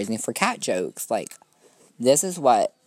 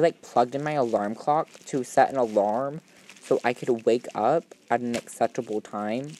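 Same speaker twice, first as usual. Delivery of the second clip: ordinary talk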